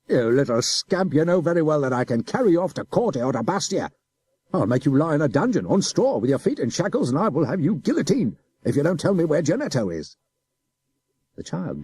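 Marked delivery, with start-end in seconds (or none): 0.1-10.2 loud officer's threat; threatening adjutant. A narrator's reading. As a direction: menace the boy.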